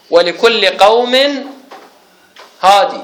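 slow recitation, "هادي" ending on long ee; Ibn Kathir's reading. Shown as a demonstration. Teacher recites the verse as example, "هادي" with long final ee. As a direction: sound the final yaa of "هادي". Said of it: "فقال هكذا في قراءته".

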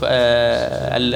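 usual speaking voice

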